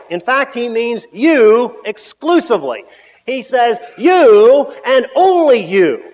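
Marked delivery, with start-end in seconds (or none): 4.0-6.0 "You and only you" slow, loud, each "you" driven home; emphatic you. Shown as a demonstration. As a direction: hammer "only you" slowly. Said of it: "there is emphatic".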